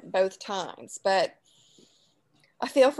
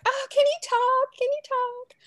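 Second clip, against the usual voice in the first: squealing high teasing voice; high mock Northerner; 4 dB louder than usual. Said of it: "they're like".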